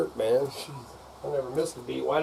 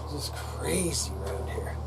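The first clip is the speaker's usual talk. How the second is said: whisper; 10 dB quieter, slower than usual. Real, not acted. Whispered aside to viewers.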